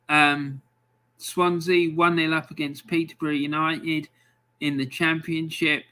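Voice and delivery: ordinary delivery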